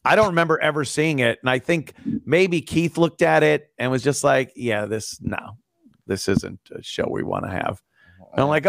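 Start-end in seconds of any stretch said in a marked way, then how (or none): 4.5-7.8 low, quiet, dismissive quote; dismissive rejection. Retold or imitated. Colleague turning down an audition tape, quietly dismissive. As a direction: imitate an unimpressed colleague, soft and low.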